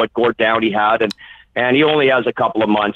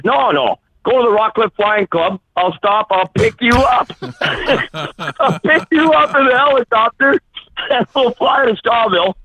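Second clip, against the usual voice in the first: excited; 9 semitones higher than usual. Retold or imitated. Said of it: "And he says".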